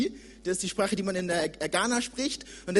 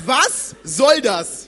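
much louder, slow mock outrage; exasperated; 4 semitones higher, 8 dB louder, much slower than usual. Preacher mocks "schwarz ärgern" to the congregation, exasperated. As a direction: throw up your hands at the phrase.

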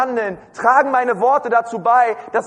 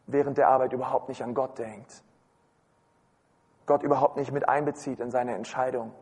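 lower, softer, measured appeal; hushed earnest preaching; 7 semitones lower, 6 dB quieter than usual. Real, not acted. Preacher urging congregation to work for God's glory, hushed and earnest. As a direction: bring it right down and speak intimately to the listeners.

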